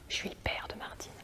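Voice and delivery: hushed whisper, stepping into the father's role; whispered aside. Acted. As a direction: breathe it as a secret aside.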